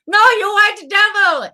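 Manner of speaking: high, loud mock Rasta holler; Rastafarian impression attempt. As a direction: yell it in a badly faked Rasta accent.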